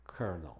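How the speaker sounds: "colonel" said as "kernel"; "colonel" in two syllables, like "kernel"; exceptional pronunciation. Shown as a demonstration. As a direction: say "colonel" with an R sound, as "KER-nul". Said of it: "esta palabra se pronuncia".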